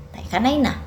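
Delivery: ordinary delivery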